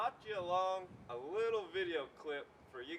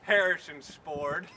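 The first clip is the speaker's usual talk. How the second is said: usual speaking voice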